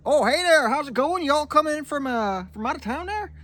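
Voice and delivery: put-on loud, very high Yooper accent; hammed-up Upper Peninsula hotel host; imitating Upper Peninsula local. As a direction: greet visitors as a chirpy, exaggerated Yooper. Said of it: "he's like".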